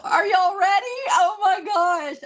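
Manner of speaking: high, loud, near-shrieking build-up; excited shout. Real, not acted. Crafter asking viewers if they're ready for the reveal, loud excited shout. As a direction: yell it out like the big reveal is here.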